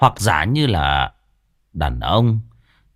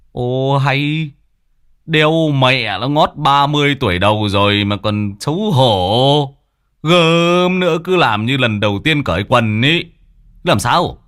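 louder, teasing, scoffing husband voice; mocking husband; 3 semitones higher, 3 dB louder than usual. Husband teases wife for blushing, loud scoffing voice. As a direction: scoff at her shyness. Husband mocks wife's shyness.

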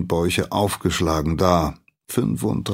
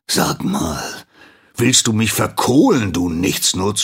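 louder, rising angry scold; 2 semitones higher, 5 dB louder than usual. Acted. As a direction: tear into the lazy apprentice. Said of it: "schimpft der Meister".

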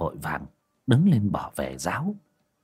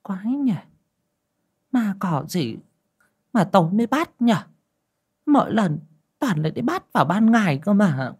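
high-pitched grumbling to herself; puzzled mutter in a much higher character voice; muttering old woman; 10 semitones higher than usual. A narrator's reading. Old woman puzzling over ghosts alone, muttering. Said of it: "quay vào lẩm bẩm".

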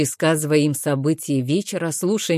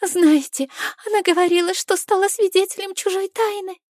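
much higher, trembling scared witness; shaken tearful witness. Acted. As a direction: quaver like a frightened witness. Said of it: "испуганную свидетельницу с дрожащим голосом".

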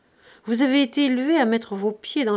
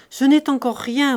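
loud forced comfort; 4 semitones higher, 6 dB louder than usual. Acted. Uncle forcing comfort aloud.

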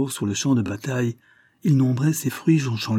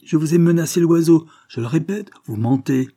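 raised, heated insistence; angry; 6 semitones higher, 5 dB louder than usual. A narrator's reading. Colonel insisting the boy threatened his bird, angry. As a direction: press the charge angrily, starting loud. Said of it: "fit monsieur Grünkopf avec colère".

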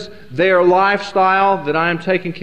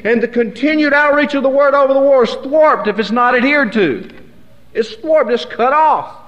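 higher, impassioned sermon delivery; preaching at full force; 5 semitones higher than usual. Acted. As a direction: drive the point home like a preacher in full flow.

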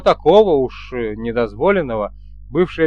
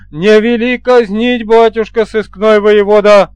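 raised, loud, drawn-out plea; mock pleading; 5 semitones higher, 6 dB louder than usual. A narrator's reading. Men begging the police commander's pardon, mock pleading. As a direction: plead loudly and slowly for mercy.

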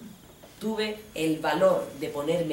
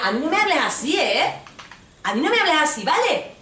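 high, louder scolding; indignant rebuke; 9 semitones higher, 4 dB louder than usual. Retold or imitated. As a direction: put him in his place. She rebukes boyfriend.

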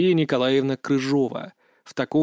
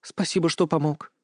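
understated, bashful gratitude; sheepish and modest; embarrassed thanks; 3 dB quieter than usual. A narrator's reading. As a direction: thank them a little awkwardly. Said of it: "затем смущённо добавила".

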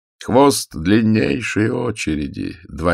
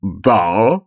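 fat irritated voice; 2 semitones higher, 4 dB louder than usual. A narrator's reading. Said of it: "жирным раздражённым голосом сказал Дон Кэо".

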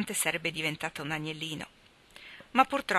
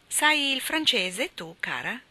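put-on old aunt voice; 7 semitones higher, 3 dB louder, slower than usual. Zia Carol voiced, high.

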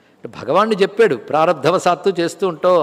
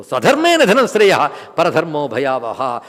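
verse recitation; 4 semitones lower, slower than usual. Acted. Quotes Sanskrit verse.

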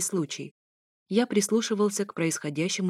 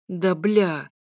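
raised-voice swearing; pained yell; 4 dB louder than usual. A narrator's reading. Narrator gets hit on the head, pained yell. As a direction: yell a curse at a sudden blow. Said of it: "завопил я".

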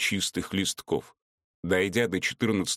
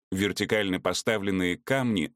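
usual speaking voice

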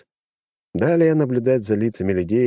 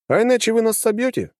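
higher, lightly surprised question; mild disbelief, pitched up; 14 semitones higher than usual. Acted. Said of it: "удивился такому раскладу".

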